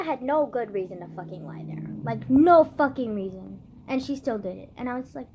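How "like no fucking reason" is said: NO stretched out slow and loud; heavy, drawn-out stress on "no"; angry emphasis. Real, not acted. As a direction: hammer "no fucking reason", slowly and loudly. Angry emphasis on the unjustified lie.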